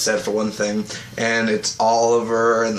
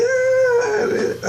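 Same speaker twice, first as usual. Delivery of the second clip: high-pitched imitation wail; mock scream of pain; 3 dB louder than usual. Reviewer imitating everyone hit by the cry, high squeal. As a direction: squeal out a hammed-up "ah".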